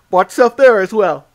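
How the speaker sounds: yawning through the words. Real, not acted. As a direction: talk mid-yawn. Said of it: "I'm yawning so much".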